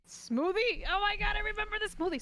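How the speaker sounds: high, fast burst of recall; sudden excitement. Real, not acted. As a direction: blurt it out as it hits you.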